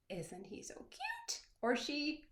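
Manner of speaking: high, soft gushing over a cute craft; cooing. Real, not acted. Crafts teacher showing kids her finished otter, cooing.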